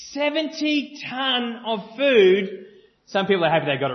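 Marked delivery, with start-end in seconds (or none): 0.1-2.7 slow reveal. Real, not acted. Revealing answer slowly.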